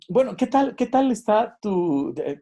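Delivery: usual speaking voice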